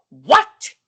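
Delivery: sudden loud "What?"; menacing mock outburst. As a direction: imitate a startled, threatening snap. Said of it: "creep turn".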